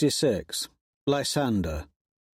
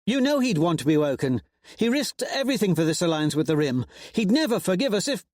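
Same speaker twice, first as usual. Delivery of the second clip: higher, fast, insistent; urgent captain; 7 semitones higher, faster than usual. A narrator's reading. Pyther to Roan, insistent argument. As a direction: argue him down quickly.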